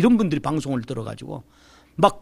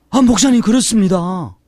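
eager reply; 5 semitones higher, 7 dB louder than usual. Retold or imitated. Excited answer when recognized.

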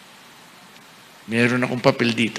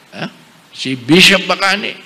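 usual speaking voice

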